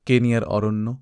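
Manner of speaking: plain reading voice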